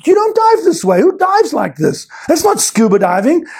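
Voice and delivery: high, fired-up rant; impassioned rant. Real, not acted. To the audience, passionate high rant. Rant against standard training.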